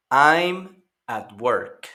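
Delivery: slow, careful English example; slow classroom model; slow model sentence. Shown as a demonstration. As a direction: read it slowly for learners to copy. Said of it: "Recuerden escuchar y repetir".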